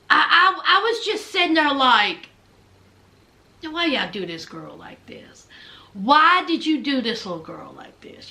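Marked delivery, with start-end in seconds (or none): 3.6-5.5 low, hushed reaction under the breath; whispered disbelief. Retold or imitated. Acts out own muttered reaction while watching. 5.9-8.3 low, deliberate scolding; voiced reproach. Repeats the muttered reproach aloud.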